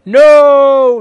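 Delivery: long, high, loud cry; shout. A narrator's reading. Teacher caught by a spell, shouting in protest. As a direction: bellow one drawn-out word. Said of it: "Lupin shouted".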